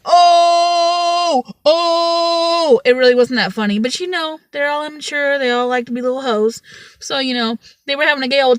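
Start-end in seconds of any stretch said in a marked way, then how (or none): 0.0-2.8 long, loud, high mock "oh"; rowdy boys jeering. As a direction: hoot like a crowd of boys at a roast. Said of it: "all the little eight-year-old boys were over there like".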